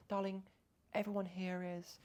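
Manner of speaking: a hint of a British nurse accent. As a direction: coo darling in a light British accent. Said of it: "British, loved it".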